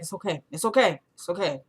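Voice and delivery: soft, hushed reassurance; comforting. Retold or imitated. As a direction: soothe her quietly. Lucian comforting crying Alex.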